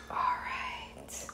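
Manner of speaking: hushed, drawn-out whisper; barely voiced, anticipatory whisper; excited whisper. Real, not acted. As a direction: whisper it, relishing the moment. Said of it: "when I start to whisper".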